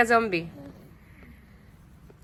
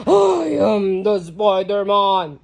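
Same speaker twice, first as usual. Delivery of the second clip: low, loud, slow monster; 7 semitones lower, 7 dB louder, slower than usual. Shown as a demonstration. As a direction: growl it out slowly as a monster. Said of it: "you're the monster".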